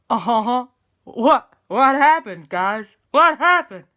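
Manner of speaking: loud, high bewildered questions; dazed El Fuego. Acted. El Fuego waking under the rocket, loud confusion. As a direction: wake up confused.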